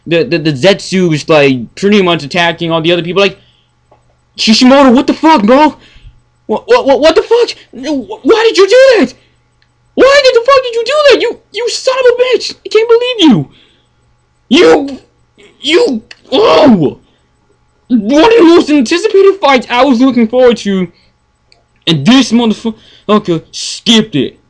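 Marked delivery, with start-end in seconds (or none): 4.4-13.5 high, squeaky disbelieving outrage; outraged rant. Real, not acted. Fan yelling at Kishimoto for skipping the fight, outraged squeal. 14.5-24.4 high, wailing protest with a cry; anguished outrage. Real, not acted. Fan wailing at Kishimoto over the skipped fight, anguished.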